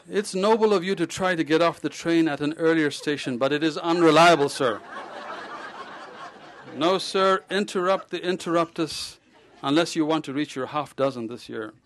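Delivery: loud, laughing admonishment; amused scolding. Acted. Doctor warning the patient against interruptus, loud and amused. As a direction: chide him through your laughter.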